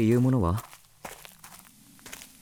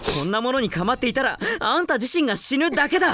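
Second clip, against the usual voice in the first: raised urgent warning; 10 semitones higher than usual. Acted. Demon pleading at her.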